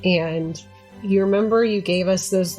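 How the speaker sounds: normal talking voice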